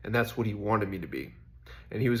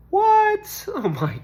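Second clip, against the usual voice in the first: sudden high, loud "What?"; a startled squeak of surprise; surprised outburst; 8 dB louder, much slower than usual. Real, not acted. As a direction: blurt it out, caught off guard. Startled outburst mid-talk.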